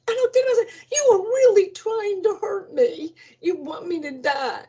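high, fast alarmed voice; high-pitched mock outrage; high indignant accusation. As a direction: squeal it in outrage. Accusing nurses after tank dropped.

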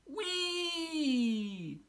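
high, sustained sliding whee; gleeful whee. A narrator's reading. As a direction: ride the slide with glee. Sliding down ice slide.

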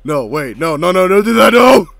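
loud, high burst of no's; frantic protest. Real, not acted. Reacting to the video, frantic yelling. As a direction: shout it in a rising panic.

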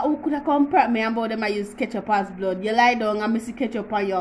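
ordinary talk

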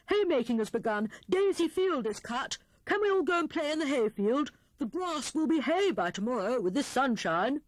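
high, quick, eager gush; excited news. A narrator's reading. Hare tells Grey Rabbit and Squirrel of haymaking, excited. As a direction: rush out the good news, pitched high.

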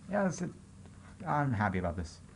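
ordinary delivery

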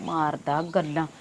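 low, slow grumble under the breath; muttering. Acted. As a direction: mutter it to yourself. Grumbles alone after visitor leaves.

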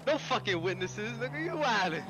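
ordinary talk

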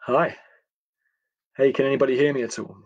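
usual speaking voice

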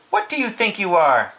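high, scolding question; commanding mother. A narrator's reading. Mother scolding her son, high commanding voice. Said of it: "commanded his mother".

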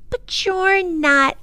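put-on high, drawn-out child's protest; high-pitched, slow insistence. A narrator's reading. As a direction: object in a high little-girl voice. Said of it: "Lindy protested".

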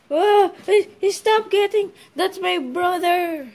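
high, loud, alarmed protest; panicked cry. Acted. Fearing for the brother, panicked. Intruder frightens child, brother threatened.

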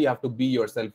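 ordinary delivery

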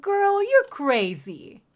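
high, loud, drawn-out protest; slow, high-pitched incredulity; sassy disbelief. Real, not acted. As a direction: draw it out loudly, scandalized. Protesting the price.

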